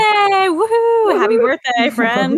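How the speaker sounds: high, loud birthday whoop; excited cheer. Real, not acted. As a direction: cheer for your friend.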